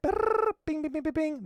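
high buzzing then bright bings; squeaky mock click sound effect; brrr bing noises. Host mimics the mouse click, squeaky and high.

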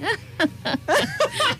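risita sutil aguda. Retold or imitated. Imita sonrisa sutil.

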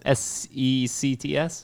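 "S E C T S" letter by letter, slow; spelled out slowly. Shown as a demonstration. Friend asked how to spell it, slow letters. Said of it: "How do you spell it?".

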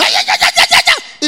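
high, loud yelping shout like a dog; shrieking mock bark. Mock shouting at Faith Mommy.

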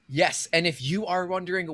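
ordinary delivery

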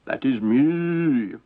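slow giant, drawn-out words; giant's voice. Acted. Giant pointing out his own figure, slow giant voice. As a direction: stretch it out like a proud giant. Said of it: "said the giant".

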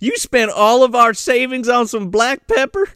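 mock-scolding Southern accent; put-on Southern accent. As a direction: question it in a hammed-up Southern accent. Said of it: "one-up each other with their accent".

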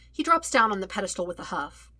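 usual speaking voice

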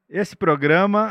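lento e alto, voz de anúncio; locutor de propaganda. Shown as a demonstration. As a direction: anuncia como locutor de rádio. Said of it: "Precisa de uma preparação pra falar da Insider". (voz de locutor)

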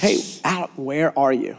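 low, softened, courteous call; polite, gentle question. Acted. God politely calling Adam, gentle. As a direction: ask kindly, without accusing. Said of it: "What an educated God we have".

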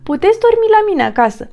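high, quick storybook invitation; eager offering beaver. A narrator's reading. Beaver invites the homeless friends home, high and eager.

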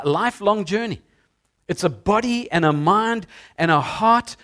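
normal talking voice

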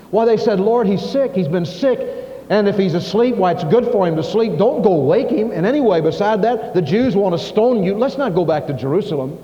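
pleading with the Lord not to go back; worried disciples. Acted.

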